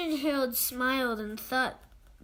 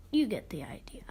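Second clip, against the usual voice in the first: whispered aside; 7 semitones lower, 4 dB quieter, faster than usual. Acted. Trailing off the principal's threat.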